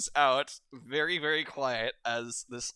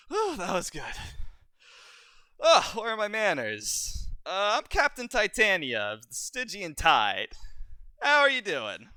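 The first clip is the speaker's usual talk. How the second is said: higher, a bit louder, courtly; gracious pirate captain; 7 semitones higher, 4 dB louder than usual. Acted. Pirate captain Titania introducing herself to the party, gracious. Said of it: "she's like".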